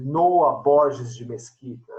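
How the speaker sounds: ordinary delivery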